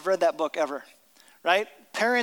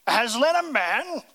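firm, louder, indignant echo of the question; stern Mister Beaver; 5 semitones higher, 4 dB louder than usual. Acted. Beaver correcting the nervous children, sternly. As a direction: scoff at the idea sternly. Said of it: "said Mister Beaver sternly".

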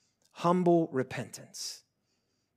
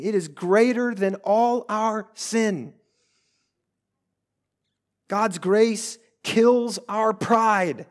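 high-pitched, ringing sermon delivery; raised pulpit voice, pressing every point; impassioned preaching; 5 semitones higher, 6 dB louder than usual. Real, not acted. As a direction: preach it with urgent conviction.

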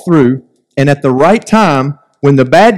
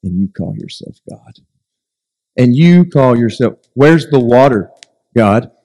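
grumbling Israelites; 4 semitones lower than usual. Retold or imitated. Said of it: "they say".

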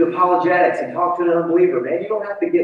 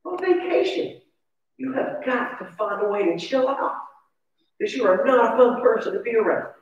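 soft, higher pleading voice of the wife; wife's voice; 7 semitones higher, 3 dB quieter than usual. Retold or imitated. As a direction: gently scold like a worried wife. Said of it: "And my wife says".

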